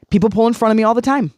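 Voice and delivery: ordinary talk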